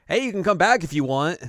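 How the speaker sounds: high, loud breezy invitation; mock casual call-out. Mama inviting them back through the path, mock calling out.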